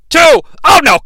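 high, loud cry of dismay; dismayed shout. Acted. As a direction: react like your favourite is losing. Despair during the pin count.

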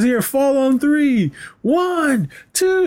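slow, high and climbing on each number; hammed-up cop counting, voice climbing; high-pitched slow count. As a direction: count him down in a squeaky rising voice.

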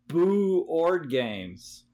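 "Boo Ord games" drawn out slowly, Boo long and falling; punny Boo Ord. Shown as a demonstration. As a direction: stretch "Boo" like a ghost. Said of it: "You get it?".

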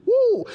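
short high-pitched "woo!"; exultant whoop. Real, not acted. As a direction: let out a high, delighted preacher's whoop. Preacher exults after reading the verse.

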